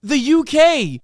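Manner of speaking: loud, high furious rant; yelling in outrage; angry shout. Real, not acted.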